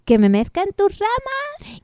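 high mocking question; mock the idea; scornful question.

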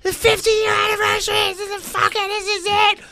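very high-pitched crowd squeal; hammed-up high frenzy; ecstatic fans. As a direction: imitate fans losing it. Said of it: "they're all like".

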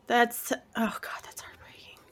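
breathless, hushed whisper; moved whisper. Real, not acted. Host to co-host after the dog story, hushed whisper.

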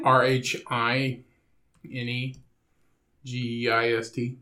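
letter by letter, slowly; spelling it out. Shown as a demonstration. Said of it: "You want me to spell it for you?".

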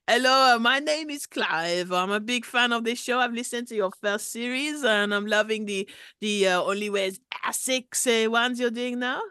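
lightly put-on French accent; a hint of a Parisian caller; mild mock French accent. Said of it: "dialing code of my accent".

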